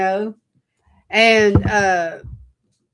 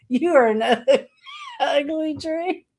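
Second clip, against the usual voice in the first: higher, laughing through the words; laughing tease; 8 semitones higher than usual. Retold or imitated. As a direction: giggle while calling the tree ugly. Teasing an ugly tree.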